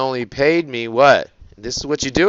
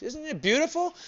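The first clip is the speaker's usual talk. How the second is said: mock rapture; 9 dB quieter than usual. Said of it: "Doesn't that just bring tears to your eyes?".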